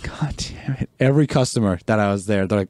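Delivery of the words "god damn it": muttered curse. Retold or imitated. Frustrated reaction retold.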